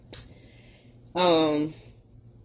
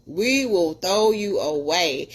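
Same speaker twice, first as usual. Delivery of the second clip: slow emphatic repeat; slower than usual. Real, not acted.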